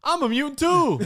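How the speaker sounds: high mock Cyclops line; lightly voiced earnest Cyclops; Scott's voice. Friend mimicking the hero's reveal, high and mock-sincere. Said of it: "Was that Scott's voice saying".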